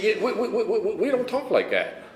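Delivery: high-pitched mock stammer, tripping over "we"; flustered stammering. Mother answering her son's love, flustered stammering. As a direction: imitate someone thrown and sputtering.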